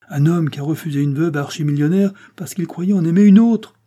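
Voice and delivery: loud, high, building to the end; exclaiming amazement. A narrator's reading. Lord Weymouth arguing for the suitor, amazed and emphatic. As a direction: marvel aloud, pushing the last words up.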